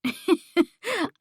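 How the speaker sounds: light, high mocking titter; a sly little giggle. A narrator's reading. Paisley laughing at Ellie over the phone, mocking titter. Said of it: "she let out another titter".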